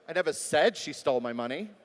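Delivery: "said" punched, ending on a rise; stress on SAID. Shown as a demonstration. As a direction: lean hard on "said". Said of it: "Maybe it was in an email".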